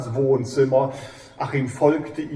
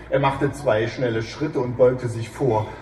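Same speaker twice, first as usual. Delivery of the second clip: normal talking voice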